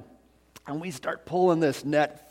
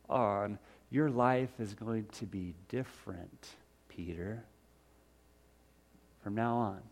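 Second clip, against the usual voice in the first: tender calling; 4 semitones lower, 12 dB quieter than usual. Acted. Jesus softly promising Peter a new life, tender. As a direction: speak the promise gently.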